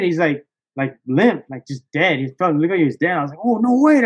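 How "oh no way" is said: higher, amazed, a bit louder; can't believe your luck; excited disbelief. Retold or imitated. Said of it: "I start fucking super excited".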